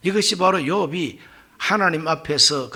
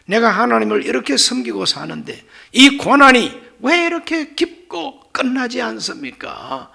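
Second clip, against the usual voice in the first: acted protest; 7 semitones higher, 5 dB louder than usual. Job complains to God of endless hardship, in raised protest. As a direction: protest upward, stretching the final question. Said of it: "이것이 바로 항변입니다".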